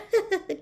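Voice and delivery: high, short jovial chuckle; a warm little laugh from the barman; hearty landlord chuckle; innkeeper's chuckle. A narrator's reading. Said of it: "chuckled Tom".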